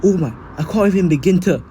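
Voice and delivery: high, loud overwhelmed gushing; Deku. Acted. Student to the hero offering him succession, loud overwhelmed outburst. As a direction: blurt it out high and loud, overcome. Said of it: "Deku would be like".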